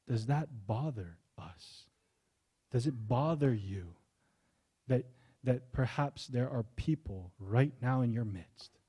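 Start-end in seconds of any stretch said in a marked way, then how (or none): none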